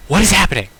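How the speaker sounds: loud, high exclamation of confusion; baffled outburst. Real, not acted. Player reacting to the strange game event, baffled outburst.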